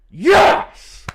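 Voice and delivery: high shouted yes; excited yes. Real, not acted. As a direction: cheer the win. Celebrating the result.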